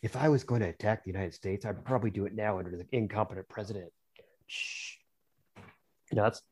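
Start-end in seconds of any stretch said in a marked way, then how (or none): 0.0-3.9 much softer, hushed confiding; quiet aside to the listeners abroad; really quiet. Real, not acted. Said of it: "I'll say this really quiet". 4.5-5.0 unvoiced, soft shh; soft shushing sound. Real, not acted. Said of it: "I'll say this really quiet".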